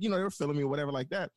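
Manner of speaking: normal talking voice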